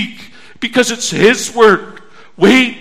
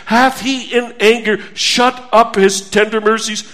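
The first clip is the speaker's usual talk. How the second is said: ordinary talk, nothing marked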